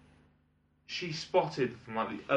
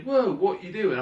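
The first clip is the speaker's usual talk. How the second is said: mock startled outburst, louder; startled whoa; 7 semitones higher, 6 dB louder than usual. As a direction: blurt it out in shock. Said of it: "I couldn't just say".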